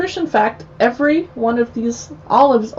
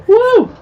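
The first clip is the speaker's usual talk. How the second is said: relieved woo; 14 semitones higher, 6 dB louder than usual. Real, not acted. Relief after swallowing.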